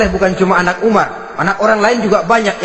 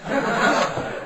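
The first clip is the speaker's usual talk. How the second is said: 1 breathy laugh; 6 semitones higher, 9 dB quieter than usual. Real, not acted. Laugh after reveal.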